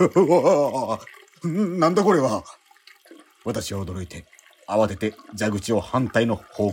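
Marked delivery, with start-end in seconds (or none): 0.0-2.4 much higher, louder shocked yelp; startled cry. Acted. Man shocked as grey liquid gushes from his chest, yelping. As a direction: jolt back in shock.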